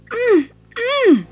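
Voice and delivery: high straining hums, pulling hard; straining grunts. Acted. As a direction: strain like tugging something too heavy. Tom pulling the heavy key.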